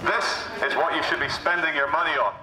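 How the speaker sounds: ordinary delivery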